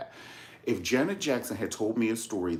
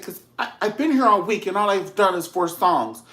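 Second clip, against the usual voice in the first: higher and louder, building frustration; frustrated singer scolding the producers, getting louder; retold angry rant; 9 semitones higher, 8 dB louder than usual. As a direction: vent at the laughing producers. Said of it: "she got pissed".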